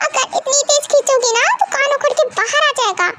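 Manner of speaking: high, pained protest; ear pulled. Acted. Daughter pleads as mother pulls her ear, high. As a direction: yelp and plead while your ear is pulled.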